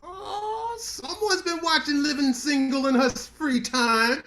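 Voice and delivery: high, loud, slow teasing drawl; mock-surprised tease. Ribbing her about watching Living Single, hammed-up. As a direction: lay the teasing on thick.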